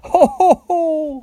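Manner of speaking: very high, loud hooting ho-ho; acted laugh. A narrator's reading. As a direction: hoot with laughter as a little pig. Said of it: "laughed the second little pig".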